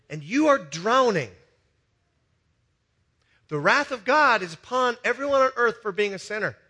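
loud, high preaching build; full-force preaching. Acted. Preacher warning the congregation, loud and rising. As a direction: preach it hard over the room.

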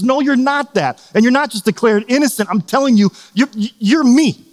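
lightly acted Jesus, insisting earnestly; Jesus speaking emphatically. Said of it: "And Jesus says".

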